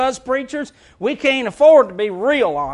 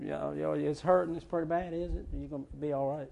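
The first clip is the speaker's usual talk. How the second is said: soft fake sympathy; 6 semitones lower, 14 dB quieter than usual. Fake sympathy to hurting member.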